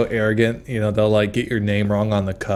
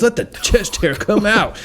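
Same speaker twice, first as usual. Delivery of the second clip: high bro barista voice; 10 semitones higher than usual. Retold or imitated. Barista voiced in comedic bit.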